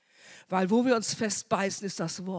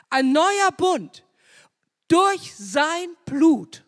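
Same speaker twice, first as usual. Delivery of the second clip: loud, slow, higher proclamation; fervent climax; 7 semitones higher, 8 dB louder, much slower than usual. Real, not acted. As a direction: preach it out, stretching "Blut". (Prediger ruft laut und langsam den neuen Bund aus)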